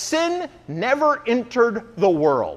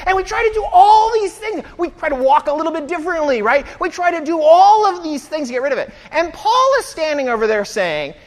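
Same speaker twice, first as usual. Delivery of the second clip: ordinary talk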